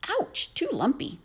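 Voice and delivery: put-on a fussy girl finding the chair too hard; pouty high ouch; Goldilocks complaining. A narrator's reading. Goldilocks tries bed.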